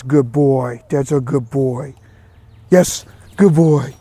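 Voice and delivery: lavish the dog with praise, stretching each word. Real, not acted. Praising the dog after sit.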